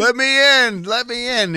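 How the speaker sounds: high, loud pestering cry; mock pleading shout. Host imitating guest begging at the door, mock shout. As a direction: call out like banging on the glass.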